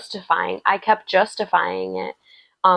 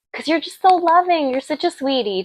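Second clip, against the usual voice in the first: higher, sweet cooing voice; doting coo; 8 semitones higher than usual. Real, not acted. Tarot reader cooing at the viewer, doting and sweet. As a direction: gush over them like a sweetheart.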